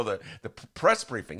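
ordinary talk, nothing marked

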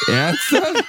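high, shouted disbelief; shocked outburst. Real, not acted. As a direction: yell it in real shock. Shocked reaction to the story. (lauter, hoher ungläubiger Ausruf)

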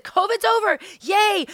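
high, loud sarcastic celebration; mock cheer. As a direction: cheer it out mockingly.

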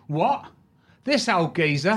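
mock Cockney sneer; Cockney fan. Cockney stranger dismissing the old actor, lighter Cockney. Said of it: "he went".

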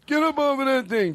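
put-on high, creaky old man singsong. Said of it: "That's just old man voice".

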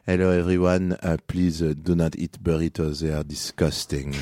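put-on French voice; French accent. Shown as a demonstration. Comedian does French on the host's request, French accent. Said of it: "French again?".

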